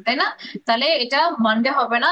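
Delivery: ordinary talk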